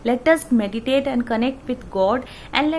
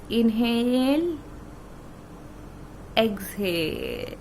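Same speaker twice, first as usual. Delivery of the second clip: measured meditation guide with long pauses between phrases; guided meditation; 3 dB quieter, slower than usual. Real, not acted. Meditation teacher guiding friends through breathing and praise to God, guided meditation. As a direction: lead them through each breath, unhurried, drawing out the key words.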